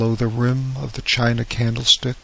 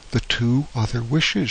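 plain reading voice

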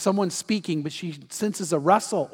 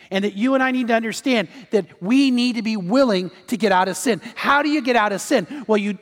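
loud, higher-pitched, urgent exhortation; forceful preaching; 5 semitones higher, 6 dB louder than usual. Real, not acted. Preacher urges listeners to get out of sin, loud and urgent. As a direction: press the appeal home with raised voice.

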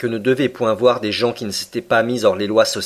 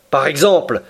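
sharp, higher outburst; exclaiming; 5 semitones higher, 4 dB louder than usual. Acted. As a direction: blurt it out. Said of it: "s'écria Ned Land". (Ned Land s'écrie, sec et fort)

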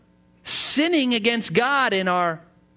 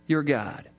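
normal talking voice

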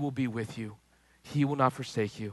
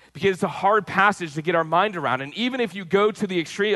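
higher and louder, driving sermon build; full-force preaching; 7 semitones higher, 6 dB louder than usual. Acted. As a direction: preach it with rising fervour to the congregation.

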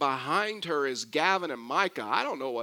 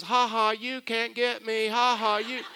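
higher-pitched playground teasing, imitated; mock child's taunt; 5 semitones higher than usual. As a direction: imitate a gloating toddler safe in grandpa's arms. Toddler taunts brothers from grandpa's arms.

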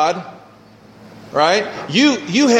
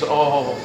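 weak-kneed groan; 3 semitones higher, 6 dB quieter than usual. Pretend. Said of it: "weak in your knees".

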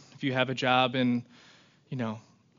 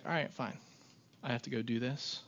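a quiet, softer \"all right, fine\" with no fuss; soft resigned acceptance; 9 dB quieter than usual. Pretend. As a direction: shrug it off and just agree, softly. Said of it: "if you just like".

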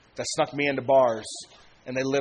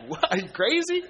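incredulous friends; 5 semitones higher than usual. Retold or imitated. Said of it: "They're like".

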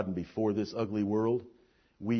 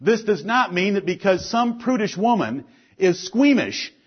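emphatic, projected sermon delivery; forceful preaching; 10 semitones higher, 10 dB louder than usual. Real, not acted. Pastor warning the church about sin, forceful preaching. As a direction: preach it firmly, landing key words.

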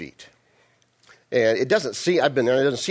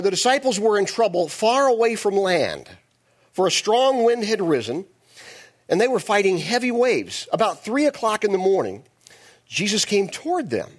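dramatic scripture reading; 8 semitones higher than usual. Acted. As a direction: read the storm scene with drive. Reads scripture aloud.

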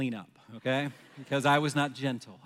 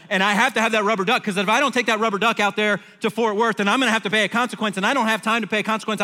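raised, high-pitched frantic rant, tumbling out in a rush; panicked loud demand; 6 semitones higher, 6 dB louder than usual. Acted. Husband demands the rubber duck from his wife, in loud panic. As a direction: re-enact a loud, frantic phone tirade. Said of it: "Kind of at that volume and that tone".

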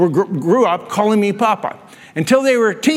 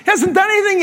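puzzled question; 11 semitones higher than usual. Retold or imitated. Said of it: "I'm thinking".

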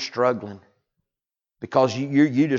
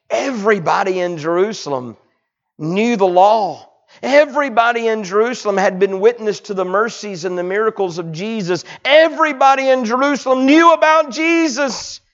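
high, loud preacher's sermon; fiery preaching; 11 semitones higher, 8 dB louder than usual. Acted. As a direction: preach it at full force to the pews. Preaching at full force.

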